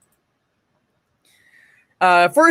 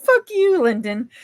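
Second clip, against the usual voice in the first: mock retort; 7 semitones higher than usual.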